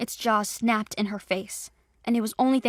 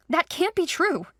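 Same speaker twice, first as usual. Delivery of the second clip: much higher, shocked denial; higher, louder disbelieving cry; cried out; 8 semitones higher, 3 dB louder than usual. Acted. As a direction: refuse to believe it, voice leaping up. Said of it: "she cried".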